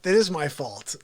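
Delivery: ordinary talk, nothing marked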